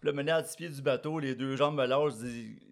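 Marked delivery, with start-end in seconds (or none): none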